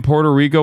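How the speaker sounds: "Puerto Rico" with a rolled R. Shown as a demonstration. Streamer follows chat's correction, rolled-R "Puerto Rico". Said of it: "Roll the R".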